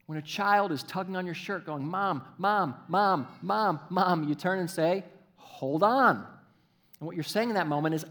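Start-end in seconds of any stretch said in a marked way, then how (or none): 1.8-4.3 lightly voiced nagging child; repeated calls of a child wanting attention; pestering child. Child tugging on a parent's shirt, pestering. 5.3-6.2 loud, higher put-off, lightly acted; exasperated parent. Parent turns to a tugging child, mildly exasperated.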